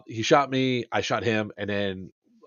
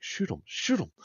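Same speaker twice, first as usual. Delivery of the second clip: higher, softer urgent hiss; urgent hushed order; 7 semitones higher, 6 dB quieter than usual. Retold or imitated. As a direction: hiss the order so nobody hears. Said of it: "And I was like".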